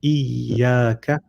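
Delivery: "Iyaka" with the doubled ya held; slow, careful "Iyaka" with a stressed double y; hung ya. Shown as a demonstration. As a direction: linger on the y in "Iyaka", as in recitation. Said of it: "hanging on the ya for a little bit because of the shadda".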